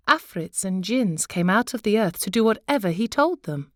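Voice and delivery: ordinary delivery, nothing marked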